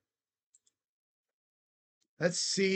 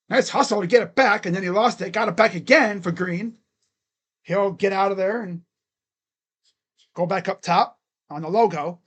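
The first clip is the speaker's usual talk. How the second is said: louder, punchy calls of each play; excited sportscaster calling a basketball game; live play-by-play; 8 dB louder than usual. Acted. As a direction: call the game like a courtside announcer.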